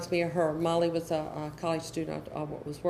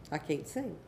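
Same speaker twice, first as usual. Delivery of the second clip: flat refusal, softer; sulky child; 2 semitones lower, 7 dB quieter than usual. Retold or imitated. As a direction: shut it down like a bored teen. Child refuses parent's suggestion.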